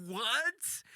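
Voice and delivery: high-pitched, rising incredulous question; mock alarmed disbelief. Passenger hearing the driver say it's bad, alarmed. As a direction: react in high-pitched bafflement.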